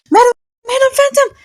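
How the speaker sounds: high-pitched ghostly calls from afar; calling out high. Acted. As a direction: shout her name in a high ghost voice.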